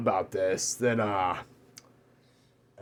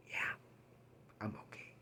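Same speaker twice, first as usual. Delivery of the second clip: tearful whisper; 5 semitones lower, 28 dB quieter, faster than usual. Retold or imitated. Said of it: "he starts, like, crying".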